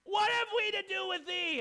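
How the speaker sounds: high, loud, slow demonic shout. Acted. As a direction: cry out like a possessed man. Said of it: "I can't do a very good demon voice".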